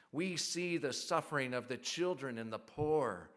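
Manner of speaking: rousing, loud call to rise up; fiery revolutionary speech. Acted. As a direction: proclaim it like a protest leader. Fiery psalm reading, rising call.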